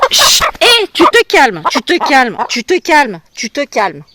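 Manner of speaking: loud, high firm commands that fade down; calming the hen. Real, not acted. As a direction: order the hen to settle, then ease off. Gronde la poule qui se débat. (ordre ferme puis plus doux à la poule)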